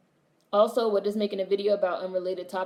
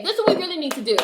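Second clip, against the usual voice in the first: excited; 9 semitones higher, 5 dB louder, faster than usual. Real, not acted. Excited plan.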